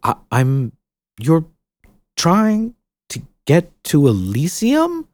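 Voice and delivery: faltering, then slow disbelief; stammer, then ask in disbelief; stunned. Acted. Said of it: "Finally, Ceridwen found her voice".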